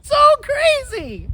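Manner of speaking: high, slow, excited shout. Real, not acted. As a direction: stretch it out in delight. Thrilled about the book twist.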